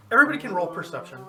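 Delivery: fast, excited announcement. Real, not acted. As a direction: rush the call out eagerly. Excited GM call.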